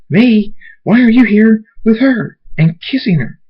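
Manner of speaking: loud, very high indignation; outraged demand. Acted. Dave catching his friend kissing her, loud outrage. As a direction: throw the question back, high and loud.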